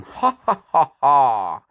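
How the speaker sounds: cackling leprechaun gloating over his gold; a cheeky leprechaun laughing at the carver; leprechaun taunt. Acted. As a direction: laugh and tease like a mischievous leprechaun. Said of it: "laughing at you, going".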